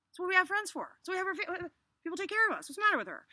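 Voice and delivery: high-pitched protest; worked-up, incredulous aside; exasperated. Real, not acted. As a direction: plead in disbelief at her choice. Teacher exasperated at character's secrecy.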